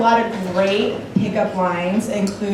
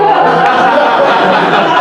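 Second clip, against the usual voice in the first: loud burst of laughter; 6 semitones higher, 7 dB louder than usual. Real, not acted. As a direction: crack up loudly. Laughs at being caught out.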